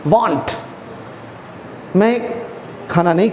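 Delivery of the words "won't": "Want" said as won't with an oh vowel. Shown as a demonstration. Teacher repeating the right answer, "Want" as won't with O. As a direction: bring out the O sound in "Want". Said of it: "ओ की आवाज़ निकालते हुए बोलना".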